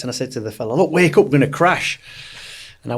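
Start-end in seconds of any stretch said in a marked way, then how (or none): 0.7-2.0 raised, higher urgent call to wake him; retold alarmed yell. Driver yelling at the dozing fighter, alarmed shout. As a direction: shout the warning as the car slides.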